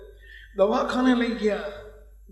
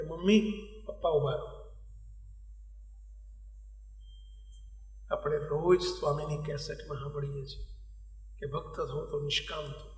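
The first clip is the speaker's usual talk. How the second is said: lightly voiced sick child, soft and low; quiet, lowered storytelling voice for a feverish boy; retold sick boy; 7 semitones lower, 11 dB quieter than usual. Feverish son stops his parents' phone call, retold softly.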